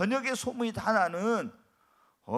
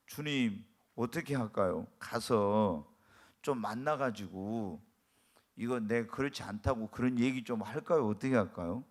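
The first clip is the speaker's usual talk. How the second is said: quiet pleading prayer; 9 semitones lower, 4 dB quieter than usual. Retold or imitated. Pastor retells his prayer.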